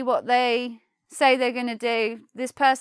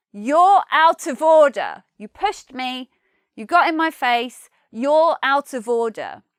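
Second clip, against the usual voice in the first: angry rebuke; 6 dB louder than usual. Acted. Man pushed in a pub confronts the pusher, angry. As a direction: push back hard at someone in your face. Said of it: "You, you then say".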